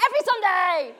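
high, loud joyful shout; joyful exclaim. Retold or imitated. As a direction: shout it proudly. Refugees' joyful reply.

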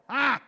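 Sarah's startled gasp, high and loud; imitating a sharp caught-out gasp; a loud, high mock gasp of surprise; imitated gasp. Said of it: "listening and went".

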